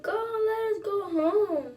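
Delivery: high-pitched mock wail; hammed-up cry to be let out; retold screaming. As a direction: imitate a panicked plea, high and stretched out. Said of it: "like, screaming".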